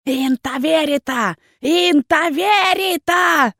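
leader's menacing roar of the name; menacing howl. A narrator's reading. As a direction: summon her with a furious drawn-out cry. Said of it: "грозный вопль лидера".

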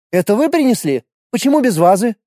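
high scolding nurse voice; stern nurse. A narrator's reading. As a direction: scold the boys sharply.

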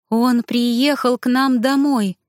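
high, slow stunned recounting. A narrator's reading. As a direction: recount it slowly in shock. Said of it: "ошеломлённо рассказывала женщина".

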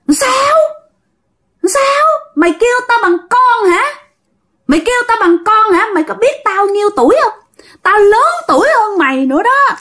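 very high, indignant ghost rant; outraged spirit. Acted. Spirit outraged at being called child, high-pitched tirade. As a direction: challenge them like an offended old demon.

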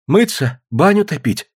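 high-pitched, bewildered question; flustered. A narrator's reading. Mother asks crowd of guests about the bath, flustered. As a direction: ask as if at a loss, voice lifted. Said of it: "растерянно спросила Наталья".

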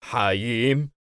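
mildly astonished question; a touch of disbelief on recognising someone; surprised. Acted. Man recognises his old friend, mildly surprised. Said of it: "الرجل باستغراب".

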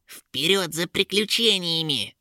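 high, excited creature voice; rally the others with foolish glee; second eager imp. A narrator's reading. Second imp takes up the bad idea, high excited cry. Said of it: "подхватил идею второй".

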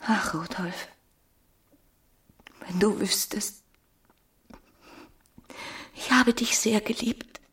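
breathy whisper rising into the confession. Acted. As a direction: confide your love in a whisper. Emma confesses love.

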